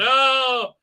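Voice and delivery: high, loud shocked "oh"; hammed-up shriek of surprise; mock startled cry. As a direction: yelp as if something stared back. Imitates shock at seeing eye.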